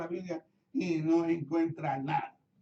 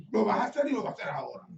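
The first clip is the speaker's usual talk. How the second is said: mock bossy order; 6 semitones higher, 4 dB louder, much faster than usual. Bossy order contrasted with polite ask.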